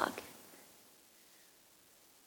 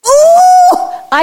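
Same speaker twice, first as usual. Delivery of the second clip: delighted gasp; 10 dB louder than usual. Real, not acted. Delighted at the picture.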